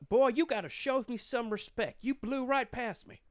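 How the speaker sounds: high, loud mock-cop scolding; stern Texas cop. Texas cop scolding a speeder, high-pitched stern imitation. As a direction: act out an indignant lawman's reprimand.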